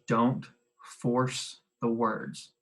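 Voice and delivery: slow, drawn out for notes; dictation pace. Shown as a demonstration. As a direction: give them time to write. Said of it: "Second bullet underneath that".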